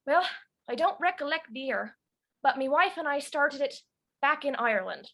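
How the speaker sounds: Irish grandfather voice; Irish accent. Shown as a demonstration. Grandfather Silas recalling married life back in Ireland, Irish accent. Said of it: "has an Irish accent".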